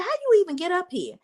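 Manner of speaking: imitating his high, disbelieving question; incredulous. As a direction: squeak out the disbelief, fast and high. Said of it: "he was like".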